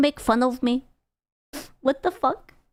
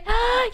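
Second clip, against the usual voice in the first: mock gasp; 11 semitones higher than usual. Fake shocked reaction.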